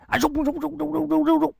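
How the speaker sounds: high rapid mouth-sound of speed; storyteller's running-feet effect; whoosh of running. Acted. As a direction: rattle off the sound of flying feet. Hero runs faster than horse.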